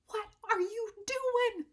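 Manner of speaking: can't believe what he's seeing; incredulous. Retold or imitated. Fellow artist confronts him outside.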